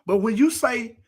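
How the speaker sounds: normal talking voice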